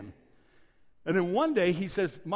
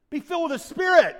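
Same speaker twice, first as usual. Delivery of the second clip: preaching proclamation; 9 semitones higher, 10 dB louder than usual. Real, not acted. Preacher proclaims scripture command.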